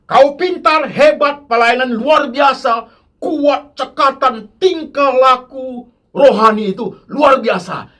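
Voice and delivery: God praising his servant; voicing God's praise. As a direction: lay out God's praise with emphasis. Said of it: "yang tadi Tuhan ngomong".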